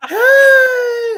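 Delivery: very high, loud drawn-out squeal; excited greeting shout. Retold or imitated. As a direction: shriek hello as you run to a friend. Retold excited greeting, running over.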